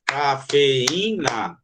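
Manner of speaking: "Cafeína" very slow, in four beats; "Cafeína" split in four slow beats; clapped syllables. Shown as a demonstration. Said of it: "Quatro palmas".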